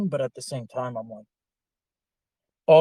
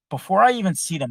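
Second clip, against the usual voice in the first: ordinary talk, nothing marked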